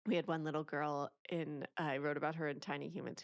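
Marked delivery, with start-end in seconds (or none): none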